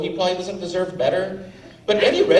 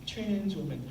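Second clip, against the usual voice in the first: breathy, much softer mock Eddie Redmayne line; Eddie Redmayne impression; 15 dB quieter than usual. Comic mocking the actor's performance, much softer. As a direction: mimic the actor's feeble voice, mocking. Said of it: "That was literally his entire performance".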